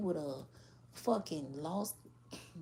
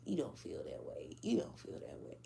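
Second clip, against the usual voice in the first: low, softer voice sliding into a whisper; low, quiet doubting whisper; low dismissive whisper; 5 dB quieter than usual. Retold or imitated. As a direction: hush it down, doubting them. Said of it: "people are fully like".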